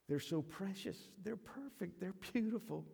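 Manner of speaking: soft, high doting voice; tender cooing. Acted. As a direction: marvel softly over a newborn. Mother treasuring her newborn.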